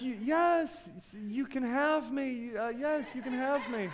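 lightly voiced girlfriend saying yes, a touch high and soft; mock-shy acceptance; softly voiced happy fiancée; imitating fiancée. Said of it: "she says".

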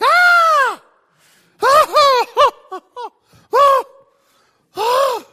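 very loud, high mock bawling wail; demonstrated squalling. Shown as a demonstration. Preacher showing the congregation what squalling is, loud hammed-up wailing. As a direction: bawl in bursts like you can't get your breath. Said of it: "he began to squall".

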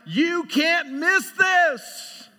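slow, loud ringing declaration; inner shout. Retold or imitated. Preacher voicing God's shout, slow and loud. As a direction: proclaim it like a voice you can't miss. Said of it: "it's almost like it's a shout".

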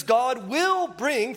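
slow, leaning hard on "will"; preacher slows to stress "will". Shown as a demonstration. Pastor teaching the congregation, stresses "will" slowly. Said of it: "future tense".

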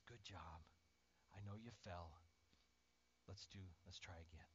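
low, hushed, tender encouragement; gentle reassurance. Acted. Jesus encouraging someone who fell, gentle and hushed. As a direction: soothe softly, close to a whisper.

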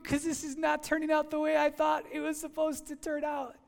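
hammed-up frustrated prayer; pleading complaint to God.

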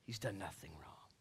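low, very soft, near whisper; quiet, low statement of innocence; hushed insistence. Acted. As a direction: drop to a grave hush. Pilate's protest drops to a hush.

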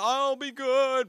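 high, loud, drawn-out promise; tearful child. Retold or imitated. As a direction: imitate a kid wailing through tears. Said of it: "but still tears coming down".

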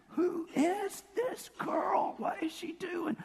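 high, drawn-out mock indignation; scandalized onlooker. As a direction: imitate appalled gossip, high and slow. Voices scandalized onlookers about the woman.

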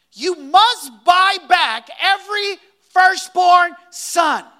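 slow, loud preacher shout on must; forceful shout. Acted. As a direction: proclaim it like a command. Preacher reads scripture forcefully.

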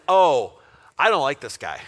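loud, low-pitched disapproval; disapproving. Acted. Disciples reacting to the unforgiving servant, disapproving. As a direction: react with a heavy, loud groan of dislike.

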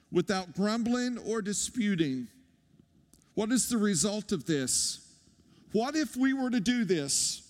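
ordinary talk, nothing marked

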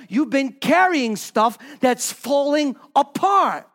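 high, straining preacher's cry; preaching at full force. Acted. Preacher crying out against unjust judgment to the congregation. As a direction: drive the point home to the church.

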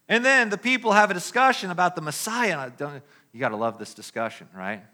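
ordinary delivery

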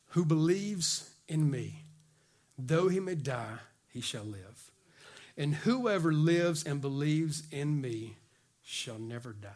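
slow, low and hushed; solemn scripture quote. Acted. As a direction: give each phrase weight and let it fade. Preacher reads Jesus' words solemnly.

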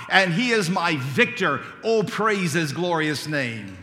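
rising fervent sermon litany, ending in a loud, slowed exultant "praise his glorious name"; building preaching. Real, not acted.

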